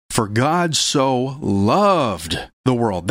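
slow, with "loved" held and stressed; slow recitation. Shown as a demonstration. Preacher reciting John 3:16 with the congregation, slow and stressed. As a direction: lead the room through it word by word. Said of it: "say it with me".

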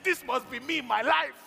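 loud, high, thrilled declaration; excited. Retold or imitated. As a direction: exclaim it like a dream just found. Retold excitement about bikers.

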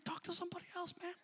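high-pitched comic confession of fear; mock scared. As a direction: pitch it way up, playing scared for laughs. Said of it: "I'm thinking".

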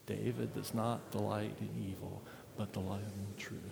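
soft, low scripture recitation with own name; quiet responsive reading. Acted. Pastor leads the church reading the verses with his own name, quietly. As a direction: read it hushed, as a confession.

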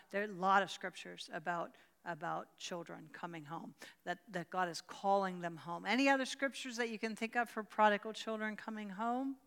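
ordinary talk

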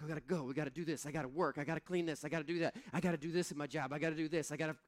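fast, breathless list of chores; fast mock frazzled rant; rushed busy rant. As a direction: rattle it off like a frazzled Martha. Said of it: "We're like".

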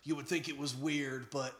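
deep sultry voice. Acted. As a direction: purr it low. Deep sultry Lincoln fan.

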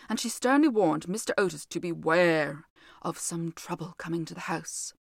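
plain reading voice

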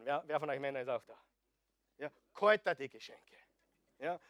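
usual speaking voice

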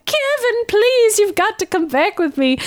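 hammed-up high-pitched pleading; Moira. Moira begging her son to come back, mock pleading. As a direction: plead melodramatically, imitating a desperate mother.

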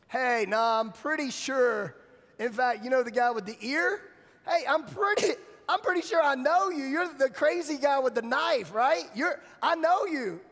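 loud, high acted accusation; insistent accuser. A bystander recognizing Peter as the guy with the knife, insistent accusation. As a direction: pester him with rising, excited recognition.

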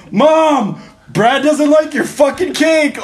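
high, loud tattling shout for mom; hammed-up outraged yell; mock yelling. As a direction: imitate a furious friend hollering through the house. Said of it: "like, yelling".

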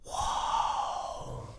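hushed, whispered amazement; soft, long awestruck whisper; awed little boy. Acted. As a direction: breathe it out in wonder. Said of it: "And the boy says".

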